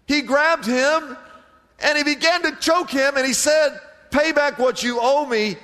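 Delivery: preacher acting out the servant's demand; angry retelling. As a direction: build the outrage of the choking.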